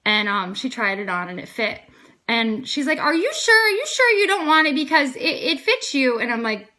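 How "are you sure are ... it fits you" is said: high, loud incredulous checking; retold as an eager, high-pitched double-check; insistent questioning. Woman offered a free leather coat, insistently double-checking.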